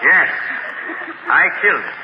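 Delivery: ordinary talk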